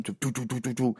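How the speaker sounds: quick doo-doo drum noises; imitated drumming. Storyteller imitating the drummer starting, mouthed drum hits. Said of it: "the drummer starts playing".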